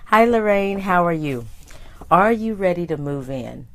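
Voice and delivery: ordinary talk, nothing marked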